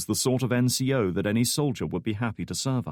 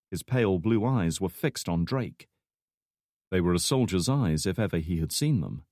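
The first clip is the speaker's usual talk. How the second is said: ordinary delivery, nothing marked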